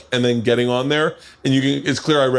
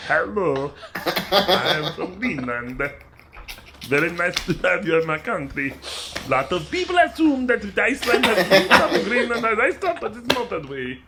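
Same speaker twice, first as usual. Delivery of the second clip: higher, halting mock Greenlandic accent; made-up Greenlandic accent; 7 semitones higher, 4 dB quieter than usual. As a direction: improvise a silly foreign accent for Greenland.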